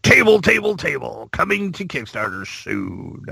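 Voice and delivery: hyped ad announcer echo, fading; radio announcer voice. Retold or imitated. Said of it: "you know, that voice".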